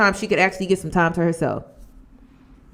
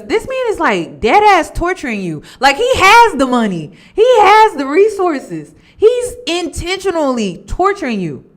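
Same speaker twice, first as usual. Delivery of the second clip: indignant; 7 semitones higher, 4 dB louder than usual. Real, not acted. Indignant rant about rich man.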